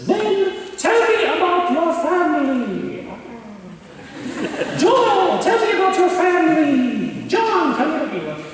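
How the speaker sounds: put-on high old witch voice calling names; old witch teacher. Imitating the strict teacher calling on each student. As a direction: squeak each name like a little witch. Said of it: "she talked like the witch from".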